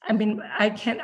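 usual speaking voice

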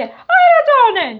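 slow, high, loud ogre; wrong ogre voice. Acted. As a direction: draw it out too high. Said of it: "non era così la voce dell'orco".

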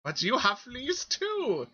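put-on old cat lady.

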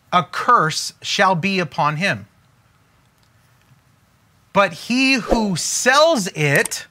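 slow, solemn proverb reading; scripture reading. Acted. Reads a proverb aloud.

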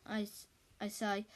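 usual speaking voice

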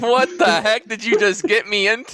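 laughing disbelief. Real, not acted. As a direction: protest through laughter at the awful pun. Reacts laughing to the pun.

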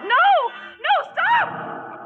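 sudden high cry of alarm; a girl's frightened high scream of warning; cried out. Acted. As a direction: shriek to stop him at the cliff edge. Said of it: "I cried out".

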